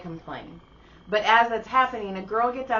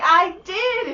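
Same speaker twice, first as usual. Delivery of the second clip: higher, louder cheery admission; cheerful; 11 semitones higher, 9 dB louder than usual. Retold or imitated. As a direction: proudly own it.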